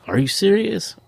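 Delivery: high, squeaky disbelieving question; retold jump of surprise; shocked disbelief. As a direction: pitch it way up in stunned surprise. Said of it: "I was like".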